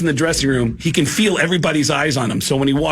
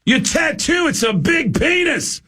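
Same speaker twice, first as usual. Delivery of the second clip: rock-singer impression; slower than usual. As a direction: bellow it like a blunt rock star. Singer voiced in story.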